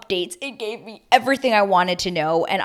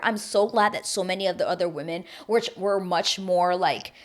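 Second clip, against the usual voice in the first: normal talking voice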